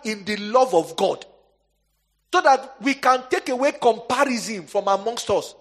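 loud, high, driving sermon delivery; preaching at full force. Acted. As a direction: preach hard to the congregation.

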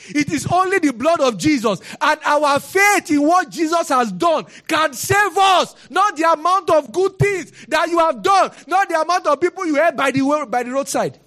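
full-force pulpit declaration; preaching. Acted. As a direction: proclaim it to the whole church. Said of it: "preaching at full force".

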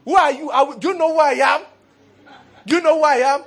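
high, loud, fast shouted challenge; imitated angry shout. Brother on the roadside challenging the other fighter, shouted. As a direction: throw the challenge like a man who just threw a punch. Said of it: "I heard the brother who hit the other person say".

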